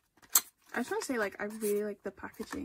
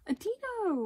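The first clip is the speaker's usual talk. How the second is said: high surprised squeal after a gasp; gasp, then squeal the name slowly; thrilled surprise, pitched way up; delighted gasp; 11 semitones higher, 4 dB louder than usual. Real, not acted. Gasps at finally getting Dino.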